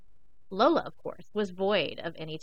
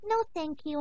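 polite puppy; 7 semitones higher than usual. Acted. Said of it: "I said politely".

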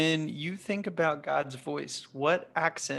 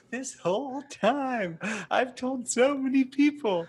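higher and slow, a playfully put-on voice; mock embarrassed believer; 9 semitones higher than usual. Podcast host extends the joke about mishearing God, mock embarrassment. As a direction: drag it out like a sheepish confession.

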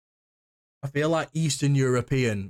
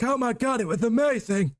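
high, hammed-up excited squeal; mock amazed gushing; 11 semitones higher than usual. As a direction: act out an over-excited fan, pitch shooting right up. Acted reaction after binge-watching the show.

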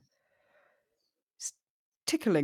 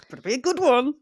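loud, higher teasing demand; playful challenge; 6 semitones higher, 10 dB louder, faster than usual. Real, not acted. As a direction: tease her with a mock warning.

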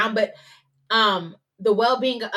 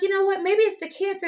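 mom's voice; 11 semitones higher than usual. Retold or imitated. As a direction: play the mom, prim and sweet. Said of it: "She was like".

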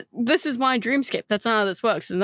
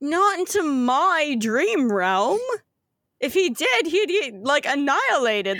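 put-on light Australian accent; 4 semitones higher than usual. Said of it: "You sound like fucking Bluey".

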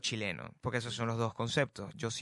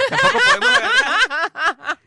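squealing high, loud laugh through the words; laughing outburst; 13 dB louder, slower than usual. Real, not acted. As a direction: crack up mid-sentence. (hablando entre carcajadas, muy agudo)

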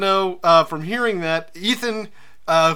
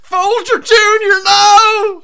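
hammed-up, much higher and louder wail; mock anguished cry; 13 dB louder, much slower than usual. Co-host mourns the drowned bird child, a hammed-up, much higher and louder wail. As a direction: cry out his name in mock despair.

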